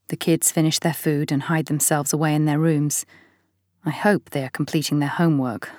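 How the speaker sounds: ordinary delivery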